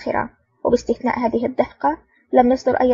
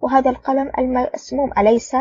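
usual speaking voice

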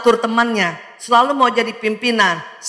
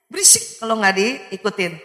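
ordinary talk, nothing marked

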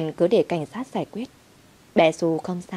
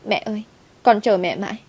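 higher, tearful; sobbing child; 4 semitones higher than usual. A narrator's reading. Little girl crying to her mother after rescue. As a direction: cry out to mummy. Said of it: "khóc nức nở".

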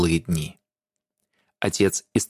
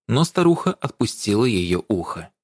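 plain reading voice

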